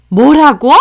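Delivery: loud, indignant disbelief; outraged demand. Acted. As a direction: react as an affronted mother-in-law. Mother-in-law outraged at refusal.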